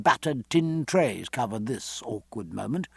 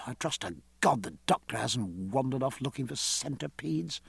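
softer muttered afterthought; lower voice; 8 dB quieter than usual. A narrator's reading. Captain going below, worrying about the doctor, lowered voice. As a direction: drop the volume as if to yourself. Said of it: "he added in a lower voice".

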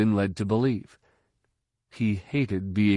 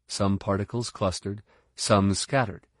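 usual speaking voice

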